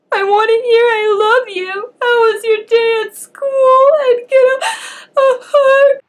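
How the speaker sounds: pleading through real tears, high and loud; crying out the plea, voice breaking; sobbing plea. Real, not acted. Retelling how she begged her mother for a hug, sobbing for real. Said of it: "I'm crying my eyes out".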